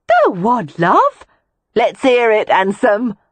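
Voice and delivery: high, loud coaxing call; teasing prostitute. A narrator's reading. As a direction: egg him on, loud and high. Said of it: "The others joined in enthusiastically".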